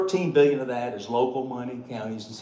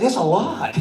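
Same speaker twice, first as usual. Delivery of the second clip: higher and louder, laughing as he teases; teasing; 10 semitones higher, 6 dB louder than usual. Retold or imitated. Treasurer teasing a congressman about his money, laughing. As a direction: drag it out, enjoying the tease. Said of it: "So now I'm just torturing him".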